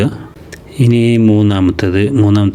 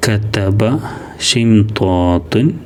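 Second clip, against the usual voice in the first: ഖാലിദീന stretched very slowly; slow model reading; much slower than usual. Shown as a demonstration. Teacher shows pupils the joined word, slow and clear. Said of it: "ഖാലിദീന എന്നാണ്".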